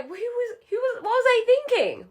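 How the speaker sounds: incredulous regret. Retold or imitated. Regretting it afterwards.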